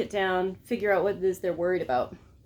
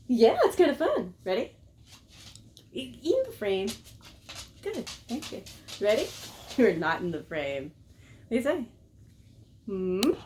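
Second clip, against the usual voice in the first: ordinary talk, nothing marked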